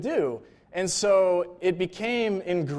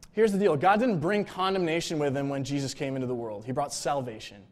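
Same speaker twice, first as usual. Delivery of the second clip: normal talking voice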